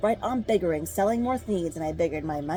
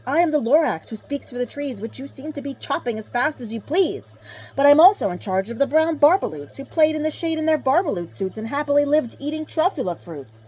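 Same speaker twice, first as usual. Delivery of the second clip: higher, louder scolding Lorax; snappy Lorax voice; 6 semitones higher, 5 dB louder than usual. A narrator's reading. Lorax snapping at the Onceler, high and loud. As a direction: snap at him like a small angry guardian. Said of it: "He snapped".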